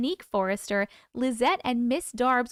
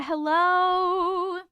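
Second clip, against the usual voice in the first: sing-song hello; 6 semitones higher, 4 dB louder than usual. Acted.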